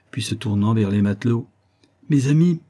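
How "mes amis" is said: higher-pitched, mildly rousing address; rally the crew. A narrator's reading. Lieutenant urging his sailors to seize the ship, rousing.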